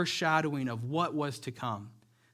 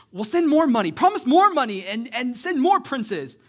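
greedy king; 10 semitones higher, 11 dB louder than usual. Retold or imitated. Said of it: "And he goes".

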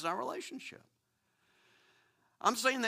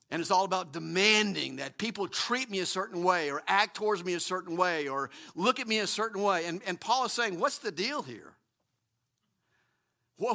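ordinary talk, nothing marked

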